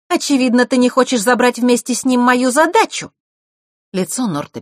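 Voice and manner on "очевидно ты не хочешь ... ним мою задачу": raised, pointed, loud jab; sharp taunt. A narrator's reading. Vasilisa needles her brother about the task, sharply. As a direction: taunt him, high and loud. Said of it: "резко произнесла Василиса".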